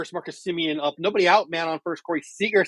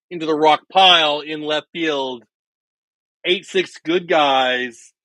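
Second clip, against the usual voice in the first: play-by-play call; 4 semitones higher, 4 dB louder than usual. Acted. Re-calls the homer announcer-style.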